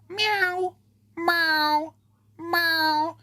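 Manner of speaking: high, drawn-out mock meow; whiny cat meows; imitated meows. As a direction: meow like the cat begging from the cat tree.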